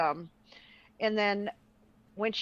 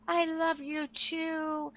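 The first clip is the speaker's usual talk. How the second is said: sweet reply; 6 semitones higher, much slower than usual. Retold or imitated. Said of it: "she goes".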